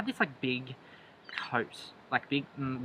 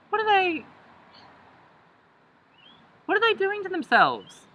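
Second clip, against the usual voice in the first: much higher, louder, amazed; shocked surprise; 6 dB louder than usual. Real, not acted. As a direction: gasp at the penguins in disbelief. Seeing the penguins mate.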